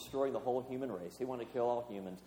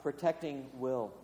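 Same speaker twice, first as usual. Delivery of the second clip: ordinary talk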